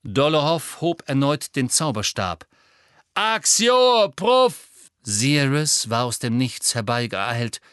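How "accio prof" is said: high loud spell cry; shouted spell. A narrator's reading. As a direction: hurl the summoning charm. Death Eater casts at Harry.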